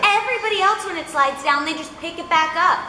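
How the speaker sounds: high-pitched, amused imitated rant; mock exasperation. Director ranting about the sinking mic stand, mock-exasperated.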